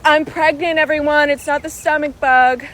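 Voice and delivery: loud, high, defensive retort; mock public announcement. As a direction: call it out as if correcting a whole cabin. Imagined announcement to judging fellow passengers.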